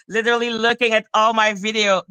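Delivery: ordinary talk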